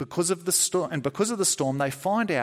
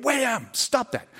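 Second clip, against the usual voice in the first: scolding the dog; 9 semitones higher than usual. Pretend.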